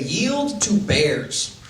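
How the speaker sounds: drawn-out, slow Yield to bears; deadpan slow sign reading; sign read slowly. Retold or imitated. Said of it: "signs that say".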